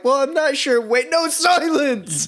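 waver, then stretch out a mock command; embarrassed diner. Embarrassed diner correcting himself to waiter, flustered then commanding. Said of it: "that you're embarrassed by".

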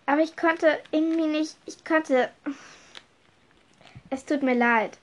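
high, faltering excuses; distressed apology. Acted. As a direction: plead that it was an accident. Max apologises, upset, faltering.

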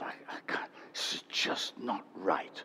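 whispered despair. Retold or imitated. Despair at having no money.

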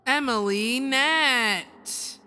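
slow, loud winner announcement; drumroll announcer. Shown as a demonstration. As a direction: draw the name out like a reveal. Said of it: "Drum roll".

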